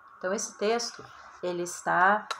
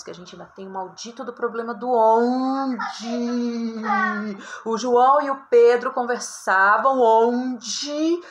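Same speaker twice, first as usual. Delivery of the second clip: mock exasperation; 3 semitones higher, 7 dB louder, slower than usual. Mocking wrong use of onde.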